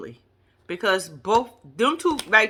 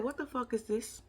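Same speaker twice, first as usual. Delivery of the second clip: much softer, stunned under-the-breath question; hushed disbelief; 15 dB quieter than usual. Retold or imitated. Host walking into a cluttered venue, hushed shocked aside. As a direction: mutter it in shock. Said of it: "I said".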